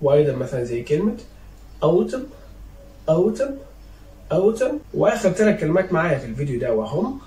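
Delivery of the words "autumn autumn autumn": "autumn" ending on m, higher and rising; teacher's lifted "autumn", final N left silent. Shown as a demonstration. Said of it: "you cannot pronounce it after M".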